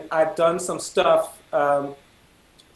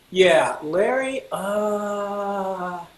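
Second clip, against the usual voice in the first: drawn-out, reluctant band director; imitating a teacher letting someone down; doubtful hesitation; 3 semitones higher, much slower than usual. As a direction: stall with a long 'uh'. Director hesitant about his offer.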